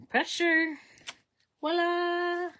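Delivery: high, bright announcer's voila; playful flourish. Pretend. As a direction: present it like a magic trick. Showing off the finished stamp.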